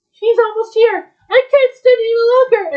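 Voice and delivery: high, loud alarm; panicking. Acted. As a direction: blurt it out as a panicked quail. Quail panics as hunter nears.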